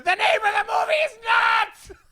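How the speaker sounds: high, strained mock scream; exasperated teacher yell. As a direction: imitate a teacher screaming at his class, dragging the last word. Enraged teacher corrects the class.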